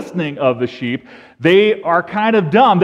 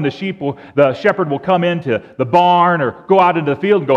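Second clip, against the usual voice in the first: ordinary talk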